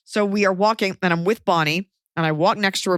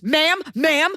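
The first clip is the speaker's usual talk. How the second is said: guard calling out; 8 semitones higher than usual. Retold or imitated. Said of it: "next thing you hear".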